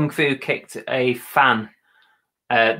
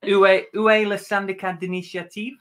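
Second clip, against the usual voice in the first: higher-pitched attempted French question; French with an English accent; schoolroom French; 6 semitones higher than usual. Shown as a demonstration. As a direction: recite a remembered French phrase. What you just said in French.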